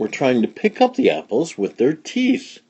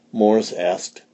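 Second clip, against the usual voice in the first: plain reading voice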